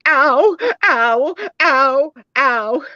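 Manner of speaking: high, loud hammed-up cries of pain; patient yelping through a biopsy, loud and high; mock screaming. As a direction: yelp each ow like a hurt patient. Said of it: "Jody starts screaming".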